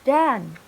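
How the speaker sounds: done with a short uh vowel; model done. Shown as a demonstration. Teacher repeating done for the class. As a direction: say done like dun.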